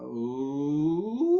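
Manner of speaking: high falsetto ooh. Shown as a demonstration. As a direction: slide up into falsetto. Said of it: "Here we go".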